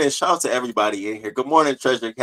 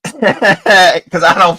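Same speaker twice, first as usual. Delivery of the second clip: laughed out, slow and louder; laughing; 6 semitones higher, 9 dB louder, much slower than usual. Real, not acted. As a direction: laugh through the line. Laughing about honest friends.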